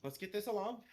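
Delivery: a touch of brisk impatience; impatient. Acted. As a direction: hurry them along, pitch lifted. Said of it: "just, like, kind of impatient".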